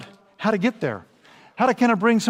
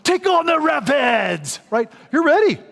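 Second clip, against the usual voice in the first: higher, louder, slow battle cry; pumped up; 7 semitones higher, 5 dB louder, slower than usual. Retold or imitated. Preacher voices the adrenaline rush, pumped up. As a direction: charge the rapids.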